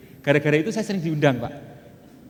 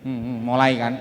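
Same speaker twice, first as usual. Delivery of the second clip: ordinary delivery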